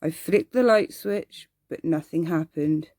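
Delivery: plain reading voice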